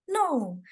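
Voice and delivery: "No" falling sharply from high; firm "No" dropping steeply in pitch; fourth tone. Acted. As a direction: start "No" high and let it plunge. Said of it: "So the last tone is".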